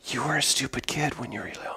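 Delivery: menacing whisper. Pretend. Shark taunts the velociraptor in a voiceless whisper. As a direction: hiss a taunt right into his ear. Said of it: "I'm gonna whisper in your ear".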